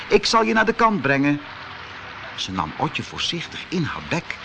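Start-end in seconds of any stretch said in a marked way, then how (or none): 0.1-1.4 reassuring mother otter. A narrator's reading. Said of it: "zei ze".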